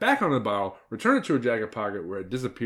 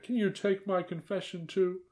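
mock whimper; 9 semitones higher, 3 dB quieter than usual. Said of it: "whimpered the aunt".

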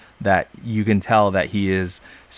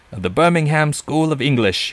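higher-pitched mock British accent; 7 semitones higher than usual. Podcaster naming the professor's school, mock British accent. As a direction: lift it into a put-on British voice. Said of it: "I don't know why I did a British accent".

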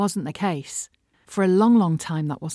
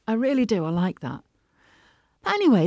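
ordinary talk, nothing marked

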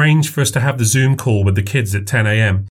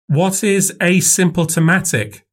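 put-on little girl voice; 8 semitones higher, slower than usual. A narrator's reading. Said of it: "asked Maisie".

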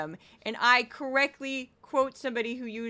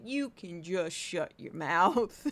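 slow, low dismissal; fed-up retort; 4 semitones lower, slower than usual. Acted. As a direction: tell them off slowly, dragging each word. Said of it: "I'm just like".

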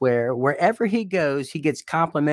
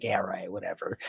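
a flat, much quieter throwaway scoff; dismissive scoff; 6 semitones lower, 9 dB quieter than usual. Retold or imitated. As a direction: brush it off under your breath. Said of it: "I'd be like".

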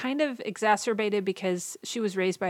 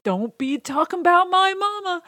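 put-on high, loud sassy kid voice; indignant kid; 10 semitones higher, 8 dB louder than usual. Jake talking back to the older kids who mocked his mother, high and loud. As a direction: snap back like a kid defending his mama.

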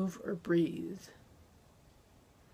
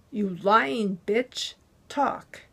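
angry demand; 2 semitones higher, 6 dB louder, much slower than usual. A narrator's reading. Muscle man threatening captive, loud and angry. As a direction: force it out, furious. Thug snarls at beaten captive.

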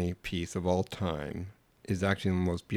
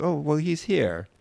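Italian woman voice; 7 semitones higher, slower than usual. Retold or imitated. Quoting Italian woman insisting.

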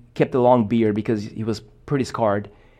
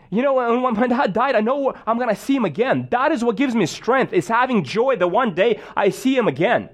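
much higher, urgent and fervent; pitched-up fervent declaration of hope; impassioned testimony; 8 semitones higher than usual. Retold or imitated. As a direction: preach your hope with conviction. Impassioned retold reply, raised voice.

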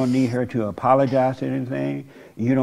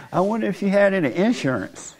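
higher, laughing through the joke; 7 semitones higher than usual. Real, not acted. Counselor joking about the mother's insurance, laughing. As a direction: giggle out the punchline.

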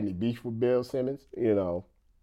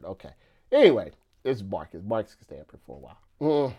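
normal talking voice

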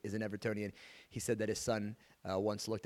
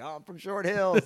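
higher, slower, lightly voiced airy comeback; mock reply; 8 semitones higher, 7 dB louder than usual. Host imitates his friend naming his hometown, higher and slower. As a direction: toss it off higher and slower, a little smug. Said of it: "And he's like".

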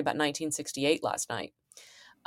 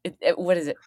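usual speaking voice

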